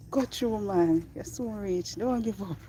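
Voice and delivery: a Jamaican cheer from the trail side; Jamaican encouragement. Retold or imitated. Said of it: "they will say".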